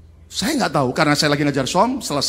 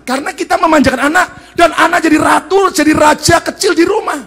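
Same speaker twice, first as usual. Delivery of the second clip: higher, louder forceful sermon; full preaching; 13 semitones higher, 11 dB louder than usual. Acted. Preacher hammering on parents who defend children blindly, loud. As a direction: drive the point home to the congregation.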